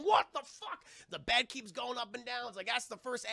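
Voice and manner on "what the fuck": high, loud mock-alarmed cry; hammed-up shriek of shock; panicked yell. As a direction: shout it in comic panic. Family panics as house shakes.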